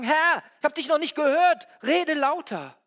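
loud, high mock demand to God; demanding shout. Preacher acting out people demanding God speak up, shouted. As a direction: call out loudly as if God hadn't been heard. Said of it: "Wir wollen, dass Gott lauter redet".

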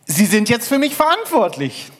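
hoch und laut von unten gerufen; Fremder am Seil. Acted. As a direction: ruf eindringlich nach oben. Fremder ruft vom Seil. (eindringlich gerufen, hoch)

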